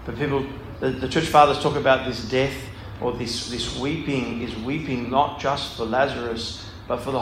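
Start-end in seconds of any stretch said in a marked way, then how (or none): none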